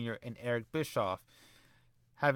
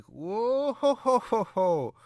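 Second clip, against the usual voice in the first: mock surprised whoa; 9 semitones higher, 4 dB louder, much slower than usual. [name] stunned by bribe money.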